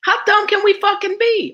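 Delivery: ordinary talk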